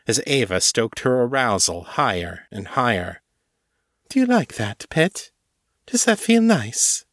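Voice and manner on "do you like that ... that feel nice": very high, teasing questions; teasing mistress. A narrator's reading. Ava questioning her pet, high and teasing. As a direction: tease from high in the voice.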